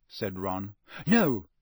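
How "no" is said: short, louder, higher refusal; curt. A narrator's reading. Girl answers her friend's complaint, curt. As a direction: cut him off with one word. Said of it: "said Hermione curtly".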